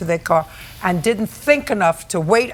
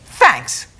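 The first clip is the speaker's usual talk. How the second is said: sarcastic thanks; 5 semitones lower, 4 dB louder than usual. Retold or imitated. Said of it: "There's a difference".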